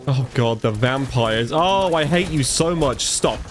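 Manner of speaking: ordinary delivery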